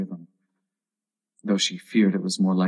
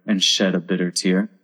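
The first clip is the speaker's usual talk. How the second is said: ordinary delivery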